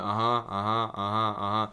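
absent nodding. Retold or imitated. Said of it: "just nodding my head".

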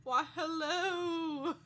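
slow, drawn-out teasing greeting back; echoed hello. Pretend. Co-host echoes the greeting in a slow teasing drawl. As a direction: drag out the hello playfully.

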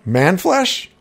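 high, rising, incredulous question; can't believe the name; blurted disbelief. A narrator's reading. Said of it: "I furrowed my eyebrow and blurted".

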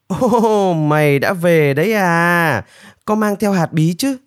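high, eager welcome with stretched words; delighted greeting. A narrator's reading. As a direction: greet the returning bird with eager glee. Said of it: "Nanpu vô cùng thích thú".

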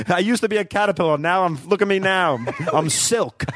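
sassy butterfly. Acted. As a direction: rattle it off like a proud diva. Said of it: "say caterpillar in that accent".

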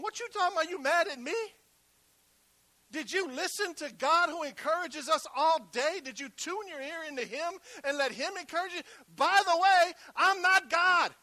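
high, loud indignant preacher's rant; mad outburst. Acted. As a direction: fire back, mad at being blamed, building to a shout. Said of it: "I'm sitting here mad. I'm going".

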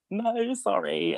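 very high, drawn-out apology; sheepish apology. Retold or imitated. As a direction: squirm through a slow, high-pitched sorry. Retold sheepish apology to guest.

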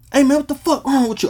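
high, loud mock yelling uncle; imitating an angry uncle yelling down the line; angry uncle on the phone. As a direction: rant at her through the phone.

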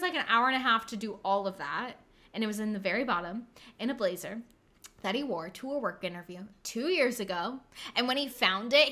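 usual speaking voice

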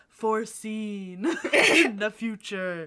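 slow, portentous, breaking into a laugh; mock dramatic. Co-host introducing Alice's vision, mock-dramatic. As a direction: draw it out like a hammed-up prophecy.

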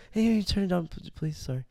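mock-timid polite request, high and softly halting; overly considerate. Imitating a friend asking to turn down static, overly considerate. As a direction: imitate someone tiptoeing through a polite ask. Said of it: "you're trying to be so considerate".

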